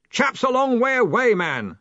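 high, blustering retort; loud protest. A narrator's reading. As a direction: bluster back at your assistant. Loud boss defends his yelling.

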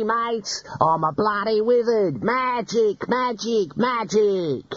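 high, jeering bandit voice; mocking bandit. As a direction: ham up the wizard mockery.

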